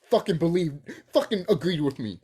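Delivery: usual speaking voice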